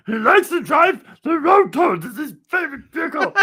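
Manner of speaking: very high hammed-up kid voice; squeaky child act. Child telling grandma about the toy's vehicle, squeaky. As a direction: squeal proudly about a favourite toy.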